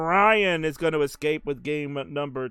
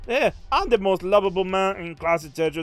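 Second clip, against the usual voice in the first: a touch higher, loud and smug; mock Marty boast; 4 semitones higher, 7 dB louder than usual. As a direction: ham up the adored player's bragging. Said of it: "caster voicing Marty's boast".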